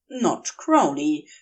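plain reading voice

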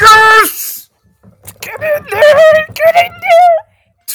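huge high yell, slow and drawn out; ecstatic shout. Real, not acted. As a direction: roar it like a goal just went in. Celebrating a goal while listening.

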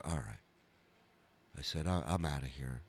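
low, quiet giving up; defeated aside. Retold or imitated. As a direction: say it under the voice, low and beaten. Retold giving up after bombing.

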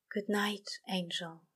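soft unvoiced whisper. A narrator's reading. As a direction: hush it like a prayer. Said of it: "whispered the demon".

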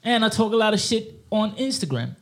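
lightly put-on London street accent; london roadman accent. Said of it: "Me, I'ma use this, what's this guy".

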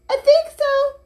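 tiny, very high mouse squeak; very high storybook mouse voice; sleepy mouse. A narrator's reading. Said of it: "said the Magical Mouse sleepily".

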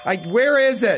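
distant slow shout; from another room. Acted. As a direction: call it from far off. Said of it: "I can't hear your".

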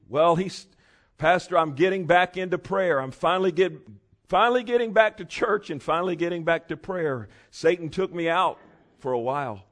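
hammed-up churchgoer pleading with pastor; churchgoer's excuse. Preacher mimicking church member excusing himself to pastor, hammed-up.

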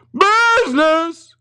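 high, loud anguished cry; ghost crying out. Acted. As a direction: wail the word out. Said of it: "cried the ghost".

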